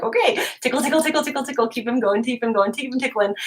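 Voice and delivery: high, running tickle chant; playful tickling chatter. Retold or imitated. Mom tickling her son against the timer, playfully. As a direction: babble playfully while tickling a child.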